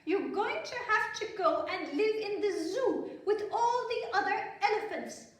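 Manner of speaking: high, loud royal pronouncement; stern queen. Acted. Queen sends her daughter off, high and loud. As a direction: lay down the law with a laugh inside. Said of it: "said the queen".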